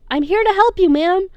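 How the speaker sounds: high, loud insistent plea; insisting. A narrator's reading. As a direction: press it on her, desperate to help. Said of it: "James insists".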